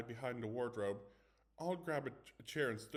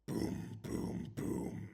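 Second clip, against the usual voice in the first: knocking sounds; 3 dB louder, much slower than usual. Acted. Knocking on the door.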